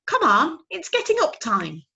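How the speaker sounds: high, loud little owl insisting; insistent owlet. Acted. Owlet insisting his father spoke. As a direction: catch him out, small and eager.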